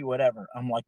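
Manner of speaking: ordinary talk, nothing marked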